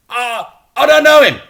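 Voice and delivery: high, loud nervous voice; flustered denial. Acted. Frank denies knowing the boy, a loud high flustered blurt. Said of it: "said Frank".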